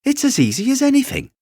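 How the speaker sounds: high, fast, showing-off squeak; breezy little show-off; boastful wild rabbit. Acted. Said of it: "said the furry rabbit".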